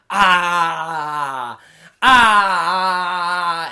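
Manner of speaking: loud drawn-out mock sigh of contentment; hammed-up blissful sigh; mock contented sigh. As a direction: soak it up with a loud satisfied "ah". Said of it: "stands in the ring and goes".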